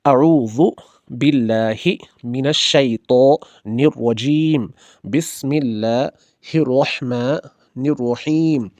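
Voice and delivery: slow, drawn-out recitation of अऊज़ु; Quranic recitation. Acted. Teacher opens the lesson with a slow recitation. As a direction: chant the words slowly and reverently.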